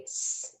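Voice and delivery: S as a bare sound, not the letter name; isolated S sound. Shown as a demonstration. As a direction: sound out S as a sustained sibilant. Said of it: "stops the O sound".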